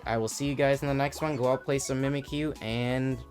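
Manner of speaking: ordinary talk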